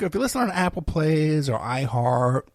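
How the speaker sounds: ordinary talk, nothing marked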